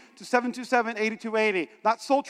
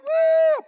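high, loud held "woo"; piercing mock cheer; imitated crowd whoop; 5 dB louder than usual. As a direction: whoop like an excited congregation. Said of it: "I thought people were like".

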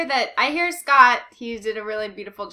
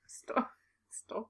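whisper; 15 dB quieter than usual. Real, not acted. Whispered stop.

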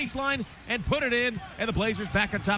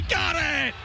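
excited call; 6 semitones higher than usual. Real, not acted. Three-pointer falls, announcer cheers.

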